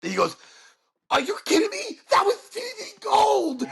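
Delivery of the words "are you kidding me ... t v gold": high-pitched, incredulous delight; thrilled producer. Retold or imitated. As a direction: gush like an overjoyed TV producer. Producer's delighted reply acted out.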